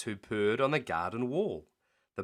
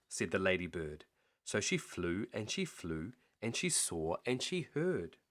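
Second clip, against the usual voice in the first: ordinary delivery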